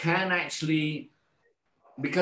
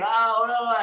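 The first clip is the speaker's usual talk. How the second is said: high, loud mock scream; imitating a scream; 10 semitones higher, 5 dB louder than usual. Bedridden patient screaming suddenly, loud imitated scream. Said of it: "suddenly he went screaming".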